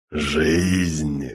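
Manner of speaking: low inhuman demon voice; as if rising from the depths of hell; eldritch horror. Acted. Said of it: "Нечеловеческий голос одержимого".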